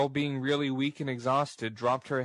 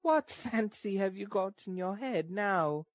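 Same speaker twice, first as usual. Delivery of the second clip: put-on high girl's character voice; high-pitched, coaxing girl; girl making light; 8 semitones higher than usual. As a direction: make light of it in a high register. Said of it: "forcing a laugh".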